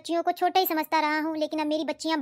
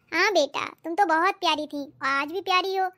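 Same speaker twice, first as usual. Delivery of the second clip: ordinary talk, nothing marked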